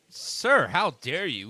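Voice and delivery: indignant, loud and high; scandalised shout at a guest; outraged hotel clerk. Pretend.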